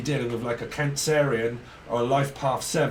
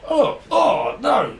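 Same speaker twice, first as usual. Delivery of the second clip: mock cry; 10 semitones higher, 6 dB louder, much slower than usual. Mock cry after the fight.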